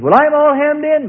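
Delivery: high, loud complaint; voiced objection. Discouraged believer protesting to the preacher, loud high complaint. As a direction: cry out the protest of someone trapped. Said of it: "He says".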